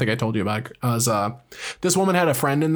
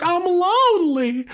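much higher, slow, whining lament; imitating a lonely old woman wailing; lonely woman crying that her family won't visit, mock wail. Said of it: "a woman crying in a chair".

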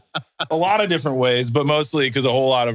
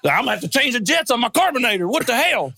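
griping carburetor racer; 10 semitones higher than usual. Retold or imitated.

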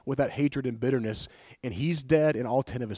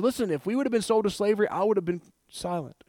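higher, rushed then slowing plea to the king; paraphrased queen's plea; 5 semitones higher than usual. Retold or imitated. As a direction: speak up earnestly as Esther before the king. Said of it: "So she said".

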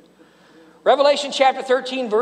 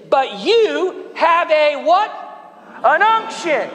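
preaching emphasis; 7 semitones higher, slower than usual. Acted. Preacher quizzing the congregation on the unction, slow and high. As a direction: stretch out the question then land it.

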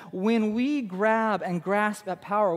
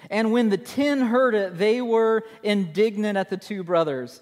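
reads the verse out louder and higher, like proclaiming it; scripture reading; 3 semitones higher, 5 dB louder than usual. Shown as a demonstration. Preacher reads verse to congregation, louder proclamation. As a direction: declaim the Bible verse to the room. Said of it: "Look at verse twenty-four".

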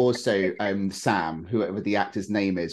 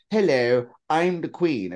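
mock royal British accent, pitched up; posh British accent; 6 semitones higher, slower than usual. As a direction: play the Queen with an exaggerated posh accent. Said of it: "her accent fluctuates sometimes".

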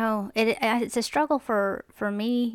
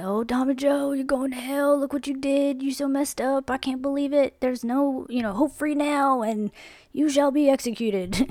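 raised, higher scolding pronouncement; mock condemning preacher; 5 semitones higher than usual. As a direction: wag a finger and damn him to hell, hammed-up. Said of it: "and be like".

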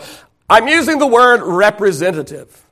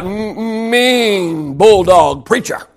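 slow, drawn-out "mean"; hammed-up, slow mocking label; mock menace; much slower than usual. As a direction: stretch it out in mock dread. Mocking churchgoers' view of preacher.

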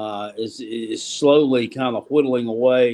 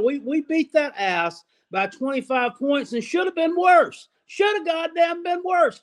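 angry; 14 semitones higher than usual. Real, not acted. Angry rant about rival fans.

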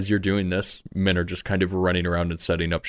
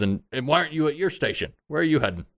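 higher-pitched crewman demanding answers; challenging deckhand; 8 semitones higher, faster than usual. Acted. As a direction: confront an idle hand in a raised pitch. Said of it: "Fred, and he says".

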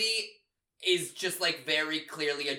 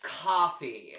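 coffee said as kwawfee, rounded vowel; Jersey coffee; 3 dB louder than usual. Retold or imitated. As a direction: mom correcting her kid, saying coffee the Jersey way: kwawfee. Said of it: "She's like".

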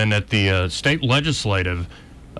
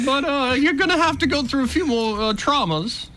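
very high, comic governor parody; governor impression. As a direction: address the public in a squeaky mock-politician voice. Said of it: "were you doing the governor or Miss Piggy".

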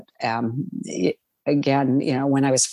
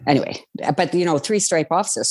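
normal talking voice

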